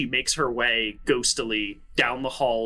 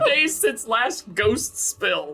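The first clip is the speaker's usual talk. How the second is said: laughing; 12 semitones higher, slower than usual. Acted. Reading imagined sign, laughing.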